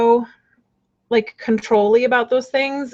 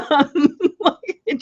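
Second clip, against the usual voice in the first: laughing; 6 semitones higher, slower than usual. Real, not acted. Laughing at mean card.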